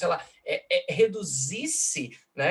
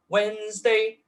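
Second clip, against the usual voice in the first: "Wednesday" high, louder, two clear syllables; strong-weak trochee; 7 semitones higher, 5 dB louder, slower than usual. Shown as a demonstration. Lecturer showing a trochee, high loud "Wednesday". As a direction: intone "Wednesday" with a strong first beat. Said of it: "Ó lá, troqueu".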